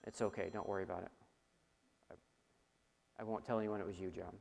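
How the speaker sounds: hushed, quick aside to one listener; quiet teasing aside. Real, not acted. Preacher teasing a congregant named John, hushed and quick. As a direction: drop low and tease him kindly, fast.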